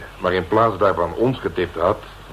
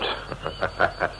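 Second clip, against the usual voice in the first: breathy whispered laugh; 2 semitones lower, 8 dB quieter than usual. Acted. Reacts to the reward.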